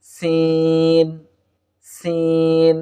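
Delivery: সিন held long with a hissed s; drawn-out Arabic sin. Shown as a demonstration. Teacher asks students to repeat সিন, drawn out. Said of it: "আমার সঙ্গে উচ্চারণ করতে থাকুন".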